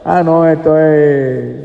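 slow, put-on doubtful inspection; acted appraisal. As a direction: stretch the words out as if examining a label. Acts someone judging the brand label.

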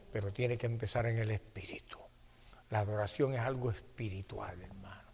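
low, very soft sermon voice; hushed preaching. Real, not acted. Preacher explaining worship of the spirit to the congregation, hushed. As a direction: drop down to a hushed, reflective teaching tone.

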